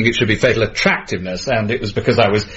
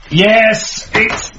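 triumphant; 10 semitones higher, much slower than usual. Real, not acted. Finishing the script, triumphant.

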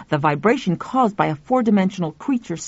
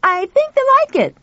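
high, fast shout; shouting back; 5 dB louder than usual. Acted. Pal shouting back to Tuffy, high and fast. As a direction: shout down happily. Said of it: "Pal shouted back".

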